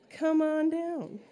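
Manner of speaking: usual speaking voice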